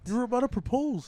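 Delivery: mock whiny girlfriend. Friend mocks a girlfriend after a breakup, in a whiny put-on voice. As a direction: whine it like a hurt girlfriend.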